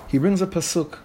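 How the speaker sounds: ordinary talk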